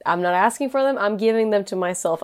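ordinary talk, nothing marked

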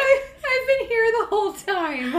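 high, laughing indignation; laughing mock protest. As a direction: whine it through laughter.

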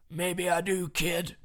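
hammed-up challenge from the boss; Vince McMahon. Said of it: "that's bad Vince McMahon accent".

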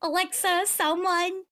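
small, high, uncertain voice; helpless asking. Acted. Guest trying names on a smart mirror, helpless. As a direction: call out tentatively, hoping someone answers.

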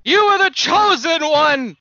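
high, impassioned outcry with loud bursts; anguished reproach. Acted. Obi-Wan berates his fallen chosen one, high anguished outcry. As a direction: cry out your betrayed hopes, pitched high.

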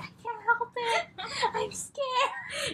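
put-on high, laughing plea of a scared girl; giggling, squeaky fake-scared excuse; laughing mock whimper. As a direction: whine it through giggles. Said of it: "And she's like".

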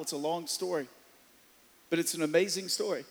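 hushed tender plea to the congregation; soft pastoral appeal. Real, not acted. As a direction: lean in and speak softly to the hurting.

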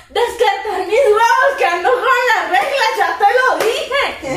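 defiant outburst. Retold or imitated. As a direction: push back at the doctor, half laughing. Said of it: "Y yo".

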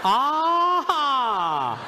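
high, loud teasing cry; mock shout. The comedian teases the audience, high loud cry.

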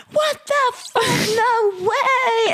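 very high, drawn-out mock squeal; amazed outburst. Imitating a drunk friend learning he lived in Australia, high-pitched amazement.

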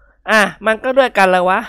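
glaring back; indignant slow reply; sulky retort. A narrator's reading. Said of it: "ค้อนขวับ".

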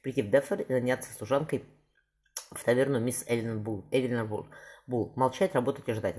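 plain reading voice

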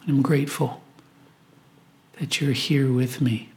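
ordinary talk, nothing marked

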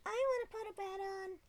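high, slurred tipsy squeal; drunk voice. Retold or imitated. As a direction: play the giddy drunk girl begging to bet. Drunk girl quoting herself.

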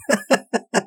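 a quick high laugh, half under the breath; short laugh. Real, not acted. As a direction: laugh at the absurdity. Laughing at the scene.

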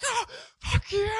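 high, drawn-out mock yell, held back; receding movie yell. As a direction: imitate someone yelling as he's left behind. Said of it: "they run away from, like, some guy".

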